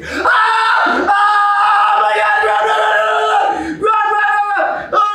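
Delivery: panicked high screaming; being chased. Real, not acted. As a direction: scream while fleeing in terror. Chased by a doll in game.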